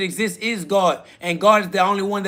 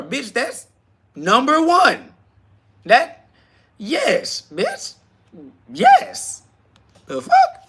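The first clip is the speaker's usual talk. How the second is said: indignant, very high-pitched retort; incredulous outburst; 8 semitones higher, much slower than usual. Acted. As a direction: pitch shooting up into a high squeal. Indignant retort to a belittling comment.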